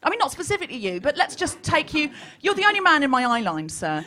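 ordinary talk, nothing marked

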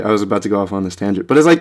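ordinary delivery

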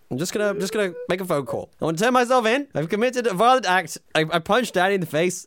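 high, loud mock panic call; hammed-up confession. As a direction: confess to police in a flap. Skit turning himself in.

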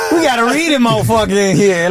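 mock heckler. Repeats the comic's heckle.

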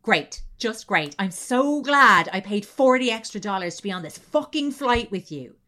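loud bitter sarcasm, spat out; sarcastic angry girlfriend. Retold or imitated. Girlfriend snapping at boyfriend on the plane, loud and sarcastic. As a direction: snap it like a furious girlfriend.

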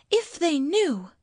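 high, swooping exclamation; reproachful wife. Acted. Wife reproaches husband about the dead, distressed.

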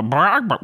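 high fake bark-squawk; bad chicken impression. Shown as a demonstration. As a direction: squawk like a person pretending to be a chicken. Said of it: "Not an actual chicken, but".

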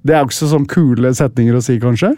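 normal talking voice

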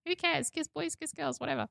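put-on high, light childish voice; carefree child. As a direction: toss it off like a careless kid. Five-year-old self voiced.

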